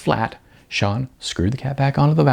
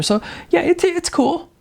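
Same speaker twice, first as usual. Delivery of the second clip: high awkward voice. Acted.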